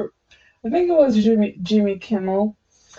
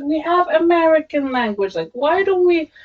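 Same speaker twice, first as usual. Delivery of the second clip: higher, lightly mimicked American girl; 5 semitones higher than usual. Quoting an American girl from a video, higher mimicking voice. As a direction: imitate her earnest complaint in a raised voice. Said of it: "She said".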